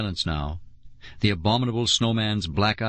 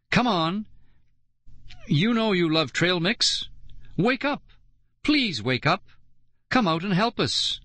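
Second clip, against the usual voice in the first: pleading; 9 semitones higher than usual. A narrator's reading. Pleading with frozen snowman.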